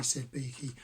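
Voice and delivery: usual speaking voice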